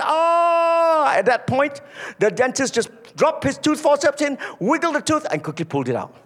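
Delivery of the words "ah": high, loud startled scream; pinched yelp. Retold or imitated. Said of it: "And she went".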